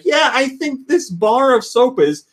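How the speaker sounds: mock motherly reply; imitated mom. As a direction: pitch it up as a helpful mother. Mom's reply, retold.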